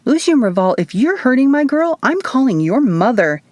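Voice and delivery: a hint of scolding threat; mock yell. A narrator's reading. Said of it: "Isadora yelled".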